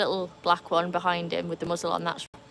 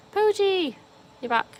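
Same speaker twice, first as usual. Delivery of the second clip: excited greeting; 12 semitones higher, 5 dB louder than usual. Real, not acted. Greeting a viewer.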